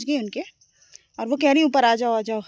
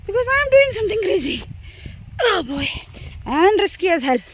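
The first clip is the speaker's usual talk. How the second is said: excited outburst; 9 semitones higher, 4 dB louder, much slower than usual. Real, not acted. Hiking alone, excited outburst.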